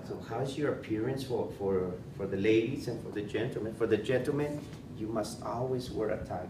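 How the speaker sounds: ordinary delivery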